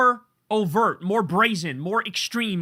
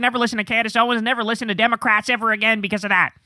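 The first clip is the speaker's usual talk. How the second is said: Ben Shapiro; 3 semitones higher, faster than usual. Acted. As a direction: race through it in a mocking, pitched-up voice. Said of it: "Ben Shapiro went around basically saying".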